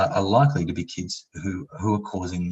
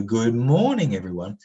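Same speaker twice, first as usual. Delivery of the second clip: bright rising-and-falling teacher greeting, louder; singsong teacher greeting; 3 semitones higher, 4 dB louder than usual. Shown as a demonstration. Teacher greeting the class with playful prosody. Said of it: "Every teacher in the world intuitively knows to go".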